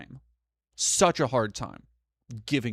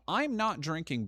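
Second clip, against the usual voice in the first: ordinary talk, nothing marked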